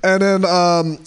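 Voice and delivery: normal talking voice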